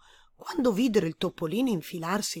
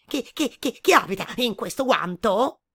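hasty rabbit; 3 semitones higher, 6 dB louder than usual. A narrator's reading.

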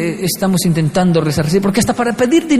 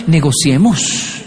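slow, drawn-out negotiator's voice; low, slow mock negotiator; imagined negotiator; 4 semitones lower, much slower than usual. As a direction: stretch it like opening formal talks. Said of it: "otra persona ahí diciéndome".

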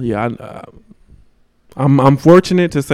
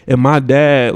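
ordinary talk, nothing marked

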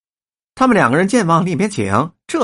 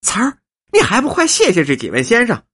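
put-on excited old woman calling out; 10 semitones higher, 5 dB louder than usual. Old woman tells Bai Mudan to thank the gentlemen, excited. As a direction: call the child over, high and thrilled.

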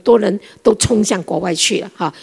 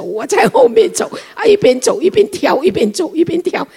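delighted; 10 semitones higher, 4 dB louder than usual. Retold or imitated. Joyful walking behind them.

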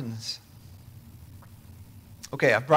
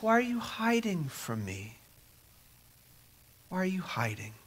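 higher, softer gentle call; tender divine voice; 4 semitones higher, 7 dB quieter than usual. Acted. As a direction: call out kindly, searching. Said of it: "God says".

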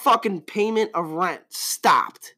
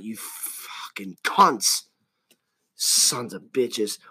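slow, much lower, whispered insult; muttered curse; 8 semitones lower, 4 dB quieter, much slower than usual. Real, not acted. Host curses greedy banks under his breath, slow whisper.

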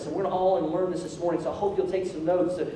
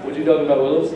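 low comic catchphrase delivery; mock-puzzled, pitched-down quip; catchphrase imitation; 5 semitones lower, 3 dB louder, much faster than usual. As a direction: drop low for a joking TV quote. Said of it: "some of y'all are like".

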